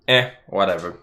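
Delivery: usual speaking voice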